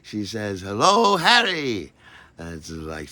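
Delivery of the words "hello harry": Maggie's voice, imitated. Maggie greeting on phone.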